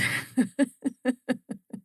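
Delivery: short real laugh at her own joke; a quick ironic chuckle; amused laugh. Real, not acted. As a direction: laugh it off lightly.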